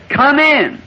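high called-out come in; calling out. Retold or imitated. Old man calling the visitors in, high ringing call. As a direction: ring out the welcome from the yard. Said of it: "he, he said".

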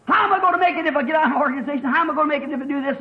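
rapid-fire acted worrying; hurried mock protest from the pulpit; fretful objection. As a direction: rattle off the excuse at nearly double speed. Voices a worried church member's objection.

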